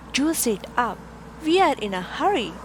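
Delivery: ordinary delivery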